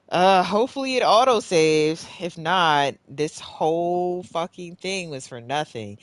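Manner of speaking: high, loud frustrated outburst; real loud venting; exasperated. Real, not acted. Player hoping the game auto saved, exasperated. Fears progress is lost.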